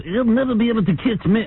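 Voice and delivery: lightly voiced cocky driver taunting paparazzi. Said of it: "He goes".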